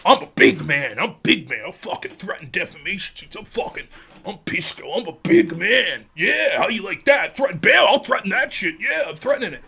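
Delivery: mocking big-man swagger, low and quiet; Pisco. Streamer parodying a lawyer who likes threatening, mock big-man voice. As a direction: play a self-satisfied tough guy under your breath. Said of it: "He likes that feeling. That's the big".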